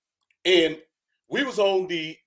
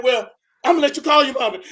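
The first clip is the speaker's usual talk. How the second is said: higher, louder, fast taunt; taunting drill sergeant; 7 semitones higher, 6 dB louder, faster than usual. Retold or imitated. Drill sergeant taunts the homesick private, fast. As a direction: rush it out as a threat.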